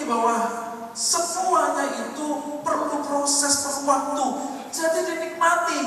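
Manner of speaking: loud, high pulpit proclamation; full-force preaching. Acted. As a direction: drive the point home at full voice. (berkhotbah lantang penuh tenaga)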